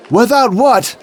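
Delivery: high, comic questioning guard; puzzled guard. Retold or imitated. Gate guard confused, high comic question. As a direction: play the dim gate guard. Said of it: "the skit goes something like this".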